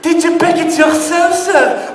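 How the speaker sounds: sing-song reassurance from the desk; chirpy desk lady. Retold or imitated. Airline clerk soothing a passenger, high mock voice. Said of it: "desk lady voice".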